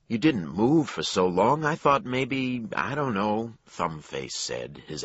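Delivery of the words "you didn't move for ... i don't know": higher, hesitant clerk voice; nervous clerk. A narrator's reading. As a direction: stammer it out awkwardly.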